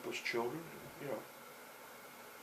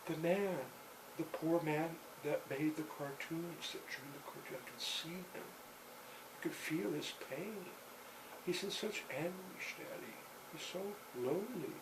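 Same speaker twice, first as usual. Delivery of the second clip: soft, higher voice, mildly acted anguish; a hint of a sad little daughter pleading with Daddy; tearful child; 4 semitones higher, 7 dB quieter than usual. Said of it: "why are you crying".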